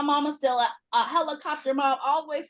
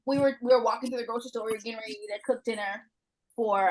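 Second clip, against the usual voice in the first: ordinary delivery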